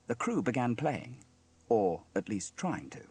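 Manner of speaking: usual speaking voice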